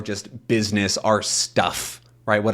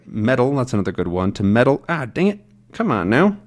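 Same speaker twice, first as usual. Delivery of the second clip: usual speaking voice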